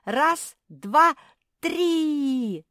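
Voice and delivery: very loud, high countdown; building to a long yelled final word; rallying count. A narrator's reading. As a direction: shout the count for a big heave, stretching the last. Counting for a joint heave.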